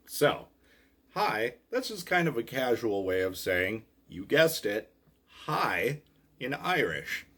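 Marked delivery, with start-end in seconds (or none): none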